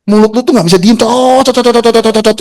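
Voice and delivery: mock her endless yapping in a high sing-song; mocking chatter. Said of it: "gue ngeledekin kayak tadi".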